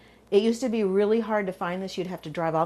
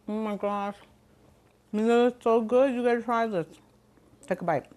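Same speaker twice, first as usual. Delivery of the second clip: talking while chewing; mouth full. Real, not acted. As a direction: gush through a mouthful of food. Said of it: "Sorry for talking with my mouth full".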